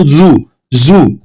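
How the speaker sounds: drawn-out जू, जू with a rounded oo; slow demo of the wrong जू sound; wrong ज़ू sound. Shown as a demonstration. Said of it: "जू की आवाज निकालते हैं". (गलत जू की आवाज़, धीमी)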